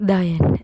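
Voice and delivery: தயன் with the tongue tip pressed hard on the upper teeth; pressed dental t. Shown as a demonstration. Teacher showing pupils where the tongue goes for தயன். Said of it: "கொஞ்சம் அழுத்தி சொல்லணும்".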